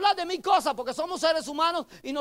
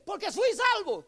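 put-on child voice; 7 semitones higher than usual. Child voiced in sermon story.

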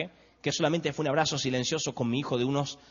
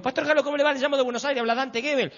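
higher, louder eager caller voice; cheery phone greeting; 9 semitones higher, 3 dB louder than usual. Retold or imitated. Caller greeting a pastor long-distance, eager and raised. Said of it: "llamo al Pastor Jaro y le digo".

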